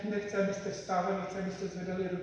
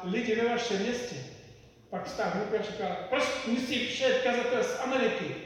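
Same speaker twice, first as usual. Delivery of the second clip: loud, high retold preacher's appeal; preaching at full force; 5 semitones higher, 8 dB louder than usual. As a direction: thunder it from the pulpit like the visiting preacher. Kazatel z Ameriky, retold preaching. (hlasité kázání amerického kazatele)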